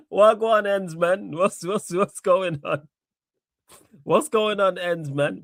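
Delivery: high-pitched, tickled greeting through real laughter; laughing hard. Real, not acted. Host greets Ends after reading his message, laughing hard. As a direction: greet him while helpless with laughter. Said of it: "You know why I'm laughing so hard".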